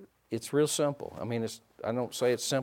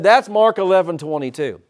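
louder, punchy, preached out; loud repeat; 6 semitones higher, 10 dB louder than usual. Shown as a demonstration. Preacher repeats the verse to a sleepy congregation, loud. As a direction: hit it hard so they wake up. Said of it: "I said".

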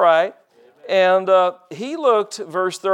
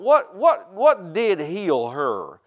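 ordinary talk

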